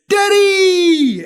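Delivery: put-on high, drawn-out child's squeal; little Caleb. Little son greets father home from work, excited squeal. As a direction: cry out like a thrilled small child. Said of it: "when Caleb was little ... he would say".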